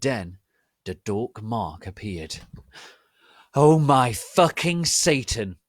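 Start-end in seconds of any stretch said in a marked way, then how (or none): 3.5-5.6 slow, louder and higher exclamation; shocked shout. Acted. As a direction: blurt it out in alarm. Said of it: "Harry shouted".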